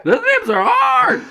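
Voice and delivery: slow high shouted line; high excited shout. Pretend. Mock talk show riffing.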